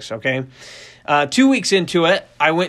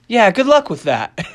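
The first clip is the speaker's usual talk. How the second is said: higher-pitched, half-laughing brush-off; amused doubt; 7 semitones higher, 5 dB louder than usual. Retold or imitated. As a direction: imitate a coach wishing luck, high and loud. Coach's amused doubtful answer retold.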